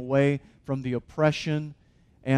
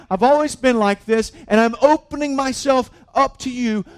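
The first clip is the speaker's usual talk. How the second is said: raised, louder preaching voice, pushing hard; impassioned preaching; 6 semitones higher, 6 dB louder than usual. Acted. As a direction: preach it out with full force as God's invitation. Preacher voicing God's invitation.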